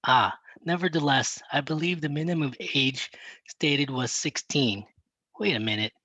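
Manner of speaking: usual speaking voice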